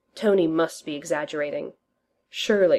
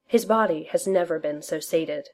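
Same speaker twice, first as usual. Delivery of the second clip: plain reading voice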